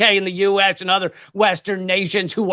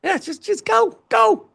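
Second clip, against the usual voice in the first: high-pitched, put-on voice shooing humans off; animals urging; 10 semitones higher than usual. Animals voting that humans just leave, high urging voice. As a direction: urge them out in a high little voice. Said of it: "that would be their response".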